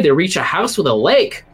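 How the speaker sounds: ordinary talk, nothing marked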